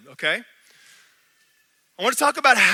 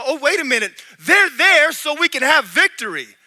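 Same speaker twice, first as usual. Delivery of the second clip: realization; 5 semitones higher, 6 dB louder than usual. Retold or imitated. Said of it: "Or I could go".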